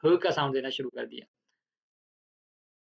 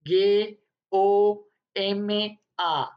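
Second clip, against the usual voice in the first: slow spelling; much slower than usual. Shown as a demonstration. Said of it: "जैसे कि गोमा".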